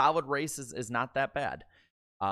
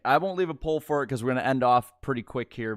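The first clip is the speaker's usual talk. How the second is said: normal talking voice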